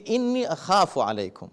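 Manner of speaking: slow, soft Quranic recitation; recited verse. Shown as a demonstration. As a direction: intone the Arabic gently and slowly. Quoting Quranic verse softly.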